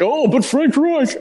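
mock the critics in a put-on voice; mocking naysayer. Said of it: "is they're like".

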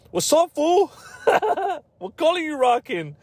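high, hammed-up gangster challenge; mock gangster. Gang member sizing up a stranger, high mock tough voice. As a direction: play a cartoon tough guy squeaking a threat.

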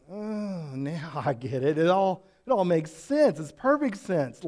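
an aha moment spilling out; imitating someone who finally understands; sudden realization. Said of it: "you go".

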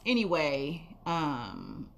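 trailing off. Real, not acted. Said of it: "what was I saying".

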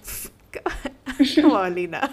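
loud, laughing scoff. Retold or imitated. Reader reacting to Alina's claim, scoffing. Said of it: "I was, like, scoffing".

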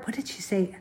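murmured self-question; whispered aside. Real, not acted. Recalling a friend's remark mid-story, whispered aside. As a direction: whisper it to yourself, trying to remember.

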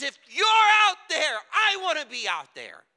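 full-voiced preacher's shout as Peter; bold shout. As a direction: shout it eagerly across the storm. Peter shouts from boat to Jesus.

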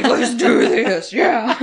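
loud, slow wrestler bellow. Retold or imitated. Sam bursting from the trunk, loud slow wrestler bellow. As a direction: hype it up like a pro wrestler. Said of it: "like fucking [name]".

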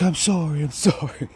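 high, sheepish mock apology; lightly voiced sulky cashier apologising. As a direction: imitate a cowed clerk, pitched high. Mimicking cashier's meek apology.

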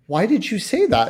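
high, puzzled mother voice; imitating wife. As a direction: press her gently like a surprised mom. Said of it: "My wife goes".

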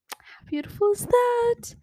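high, bright-eyed marvel at the verse; delighted gush. Real, not acted. Reader to her audience, gushing with delight. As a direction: gush over how lovely it is, voice leaping up. Moved by the verse she read.

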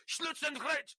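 high, loud fake German attack command; mock German command. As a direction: bark a made-up German order at the dogs. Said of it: "open the door and go".

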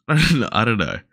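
laughing it off. Real, not acted. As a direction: laugh through the words. Laughs mid-sentence, unsure.

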